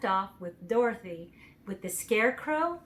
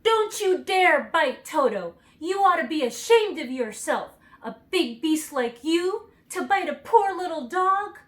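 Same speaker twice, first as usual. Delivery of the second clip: crying out angrily; 8 semitones higher, 8 dB louder than usual. A narrator's reading. Dorothy scolds the lion for attacking Toto, furious. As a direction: tell off the big beast. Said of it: "while she cried out".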